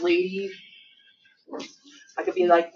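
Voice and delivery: usual speaking voice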